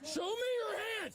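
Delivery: shout. Real, not acted. Officer confronts armed suspect.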